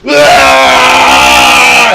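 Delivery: long low mock yell; drawn-out monster-like "aah"; imitated yell. Imitating the dead body jumping at guests, long low yell. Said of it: "he would start yelling and screaming".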